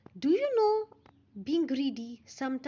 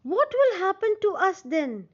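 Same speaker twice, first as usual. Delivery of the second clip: frightened fish; 7 semitones higher, 7 dB louder than usual. Acted. Fish cry out together in fear.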